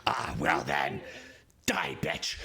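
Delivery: low menacing snarl, then a curse; drow attacking. Acted. Drow warrior threatening the player right before her attack. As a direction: sneer the threat before striking.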